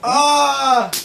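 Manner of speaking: loud startled yelp; a sudden pained shout; sharp yelp. Real, not acted. Teen burned by fire, yelping loud. Pain or shock while playing with fire.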